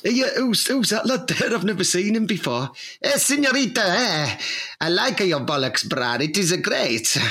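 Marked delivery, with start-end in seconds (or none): 3.0-7.2 hammed-up, muddled Greek accent; bad Greek accent. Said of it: "the worst Greek accent I've ever heard".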